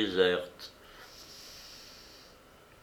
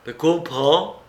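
higher, louder firm command; 5 semitones higher, 5 dB louder, slower than usual. A narrator's reading. Monique orders Marin to understand her, commanding. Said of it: "avec le ton du commandement".

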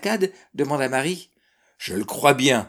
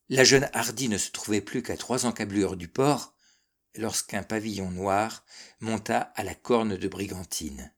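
ordinary delivery, nothing marked

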